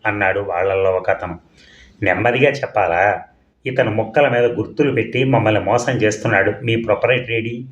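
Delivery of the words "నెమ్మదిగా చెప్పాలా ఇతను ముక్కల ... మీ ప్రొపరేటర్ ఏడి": usual speaking voice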